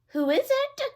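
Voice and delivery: very high squeaky old-lady imitation; a wolf faking a granny voice; wolf as grandmother. A narrator's reading. Wolf in bed pretending to be grandmother, very high. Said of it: "trying to sound like grandmother".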